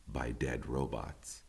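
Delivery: ordinary delivery, nothing marked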